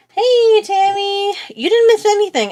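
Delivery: high, loud, bright hello to a friend; excited greeting. Real, not acted. Greeting Tammy who just joined the livestream, excited call. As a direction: call out happily to someone arriving. Greeting viewer joining the stream.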